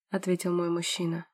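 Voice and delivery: ordinary delivery, nothing marked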